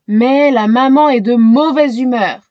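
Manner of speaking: usual speaking voice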